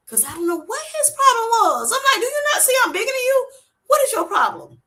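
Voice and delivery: high, loud comic outrage; shrill indignant holler; yelling at squirrel. Retold or imitated. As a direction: tell the squirrel off.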